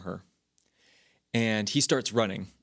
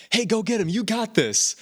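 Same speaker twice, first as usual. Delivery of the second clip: cheering; 10 semitones higher, 4 dB louder than usual. Retold or imitated. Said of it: "they're like".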